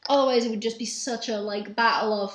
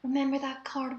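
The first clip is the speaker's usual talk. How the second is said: voiced theatrical whisper meant to carry; stage whisper. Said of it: "I should do a stage whisper so they can hear".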